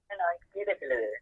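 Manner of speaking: ordinary talk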